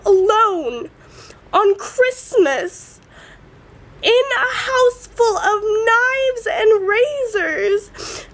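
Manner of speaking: high, drawn-out tearful protest; crying outburst. Real, not acted. Teen pleading with dad about being left at Christmas, crying. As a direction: wail it slowly through real tears. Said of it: "I bursted out crying".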